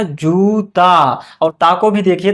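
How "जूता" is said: "जूता" very slow, जू held long. Shown as a demonstration. Teacher explaining the matra choice, "जूता" drawn out. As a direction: stretch the जू in "जूता". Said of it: "जा को थोड़ा सा देर तक".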